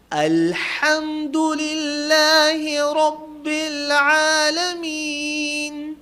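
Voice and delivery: Quran recitation. Shown as a demonstration. Teacher reciting Fatiha to class, high slow chanted tilawat. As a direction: lift the chant and stretch every vowel.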